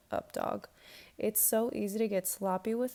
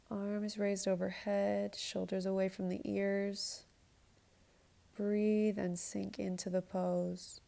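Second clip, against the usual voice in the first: yoga instructor cueing. Acted. Leads a yoga flow.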